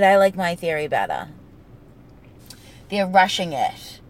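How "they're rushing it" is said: New England dropped G, rushin'; rushin' it, no final G sound; Boston accent. Shown as a demonstration. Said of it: "trying to say rushing dressing".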